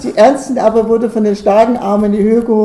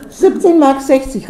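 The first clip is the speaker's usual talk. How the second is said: higher, eager shouted bid; boy calling out; 5 semitones higher, 4 dB louder than usual. A narrator's reading. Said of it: "rief Ernstchen hinter den breiten Hosenbeinen".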